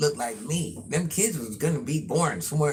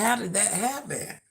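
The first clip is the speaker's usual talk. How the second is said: mild surprise; 7 semitones higher than usual. Retold or imitated. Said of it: "I said".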